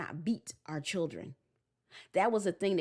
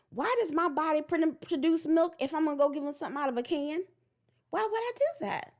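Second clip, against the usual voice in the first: high questioning voice, climbing higher at the end; mock wondering; 7 semitones higher than usual. New mother asking herself about milk, high mock puzzlement. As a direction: puzzle it out like an eager new mom. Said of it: "pick up a book and go".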